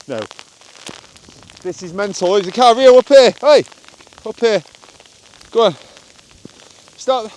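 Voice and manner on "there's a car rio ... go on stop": loud, high urgent call; calling the dog. Real, not acted. Owner warns his dog of a car, loud urgent call. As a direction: get the dog's attention now.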